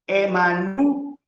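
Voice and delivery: Emmanuel drawn out syllable by syllable, rising on NU; slow dictation. Shown as a demonstration. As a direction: dictate Emmanuel slowly for note-takers. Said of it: "pongan entre paréntesis".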